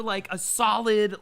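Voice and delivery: ordinary delivery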